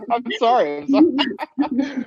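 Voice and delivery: drawn-out sorry through laughter; giggling while apologising; laughing apology. Real, not acted.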